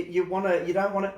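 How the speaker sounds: ordinary talk, nothing marked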